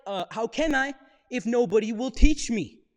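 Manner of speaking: loud, high, indignant snark; snarky retort. Acted. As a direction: throw it back loudly with sarcastic indignation. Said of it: "I'd give him some snark back".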